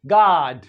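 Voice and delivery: गॉड as "gaad", long open unrounded AH; American open O. Shown as a demonstration. Teacher demonstrating American गॉड to students, long open AH. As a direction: open the vowel of गॉड like the first vowel of father. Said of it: "American English में पढ़ेंगे".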